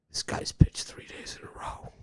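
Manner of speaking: hushed whisper of a worried announcer. Retold or imitated. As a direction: whisper it like bad news. Quoting radio announcers' worry.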